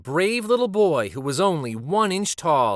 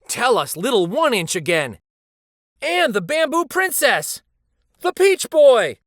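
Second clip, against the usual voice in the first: rising to high, loud calls; eager crowd calling out titles; excited calls; 9 semitones higher, 5 dB louder than usual. A narrator's reading. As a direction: cheer for favourite stories.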